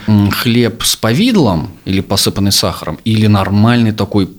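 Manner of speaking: ordinary talk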